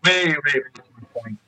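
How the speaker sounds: muffled, underwater-sounding call audio; inside a fishbowl. Real, not acted. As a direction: voice like it's coming through a fishbowl. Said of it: "it sounds like you're in a fishbowl".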